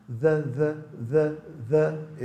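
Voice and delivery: "the" with tongue between the teeth and a buzzing voiced th; "the" drilled with a voiced th, tongue between the teeth; voiced TH demo. Shown as a demonstration. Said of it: "este é o som".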